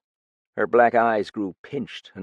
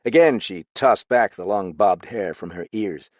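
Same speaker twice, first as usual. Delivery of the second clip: usual speaking voice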